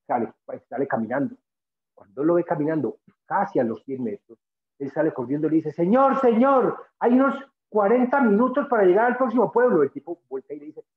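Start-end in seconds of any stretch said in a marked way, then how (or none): 5.7-9.8 calling out. Retold or imitated. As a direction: shout after someone walking away. Stonecutter calls after the traveler.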